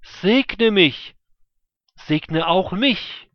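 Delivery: loud, high, slow desperate pleading; anguished plea. A narrator's reading. Esau begs his father for a blessing, pleading loudly. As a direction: beg as if heart is breaking. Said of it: "rief er".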